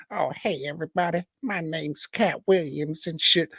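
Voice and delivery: high, put-on celebrity voice; Cat Williams. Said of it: "That's not what he sounds like at all".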